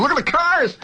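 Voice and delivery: high eager call; dad pointing out the cars again; father calling out. Retold or imitated. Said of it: "that's the first thing he'd look at".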